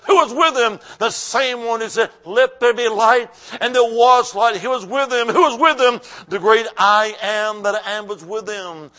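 loud call-and-answer cadence, questions pitched high; impassioned preaching. Real, not acted. Preaches at full force, call and answer.

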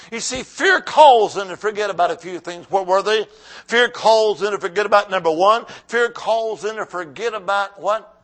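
loud, insistent sermon delivery; forceful preaching. Real, not acted. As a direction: drive the point home to the congregation.

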